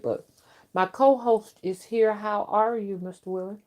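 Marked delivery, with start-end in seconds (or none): none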